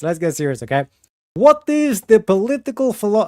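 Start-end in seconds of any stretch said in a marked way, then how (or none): 1.3-3.2 higher, louder put-on accent on "What is the political philo-". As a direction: start the question in a hammed-up mock accent. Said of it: "okay, I won't do an accent".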